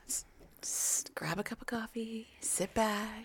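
soft whispered invitation; whispering. Real, not acted. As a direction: whisper it, cozy and hushed. Setting a cozy reading mood.

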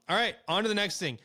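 ordinary talk, nothing marked

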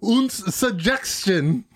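slow hammed-up German accent. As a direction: stretch it out in a comic German accent. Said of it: "That's my German accent".

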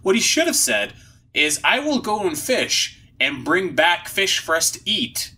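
ordinary talk, nothing marked

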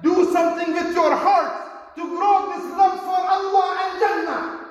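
impassioned sermon peak; preaching at full force. Acted. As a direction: rouse the congregation hard.

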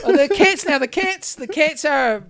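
high laughing wail; half laughing, half crying about the cats. Real, not acted.

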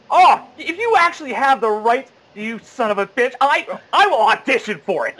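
high, loud acted declaration; emphatic vow. Man vows to his friend he will audition, emphatic vow. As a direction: blurt it out high and forcefully. Drunken boast to friend, retold.

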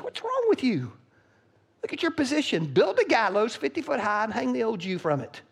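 scornful wife. Acted. Wife scolding Haman, high and scornful. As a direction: scoff at him, then command.

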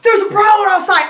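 high, loud urgent warning; acted alarm.

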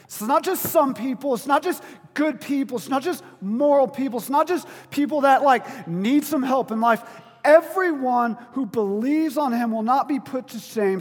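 loud, high, impassioned sermon delivery; fervent pulpit climax; full-force preaching. Acted. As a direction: preach it to the back row.

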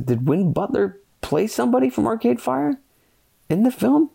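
reading a surprising fact online; surprised question. Real, not acted. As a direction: ask it in disbelief, pitch leaping up.

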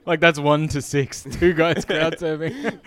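laughing. Real, not acted. Laughing at the crowd surfing.

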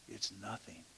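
hushed reply. Retold or imitated. Whispered retort in silence.

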